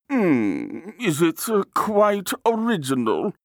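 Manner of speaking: high, hesitant connoisseur's query; appraising artist. A narrator's reading. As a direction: hum and hesitate, then ask in a high voice. Artist examines the nose.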